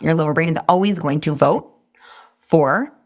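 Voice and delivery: ordinary delivery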